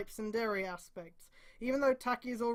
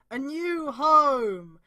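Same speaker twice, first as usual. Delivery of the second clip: mock grand announcement; 3 semitones higher, 8 dB louder, much slower than usual. Dramatic reading of chapter title.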